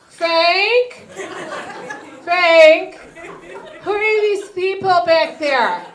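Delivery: high, loud calling out; alarmed mom. Retold or imitated. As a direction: neighbor mom calling, worried. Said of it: "My friend's mom is like".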